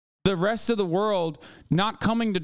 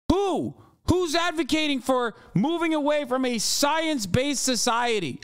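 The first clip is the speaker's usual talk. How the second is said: heated rant; 8 semitones higher than usual. Real, not acted. Ranting question at chat.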